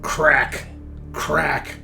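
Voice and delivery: normal talking voice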